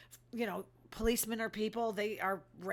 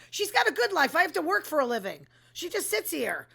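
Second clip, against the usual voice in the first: mock indignant whine; 5 semitones higher, 4 dB louder than usual. Whining as if the cat.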